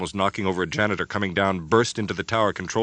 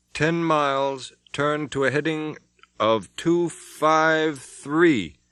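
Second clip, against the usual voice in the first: slow, calm radar report; 8 semitones higher, 4 dB louder, much slower than usual. Acted. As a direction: read it out very slowly and calm. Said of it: "the calm voice of the radar operator".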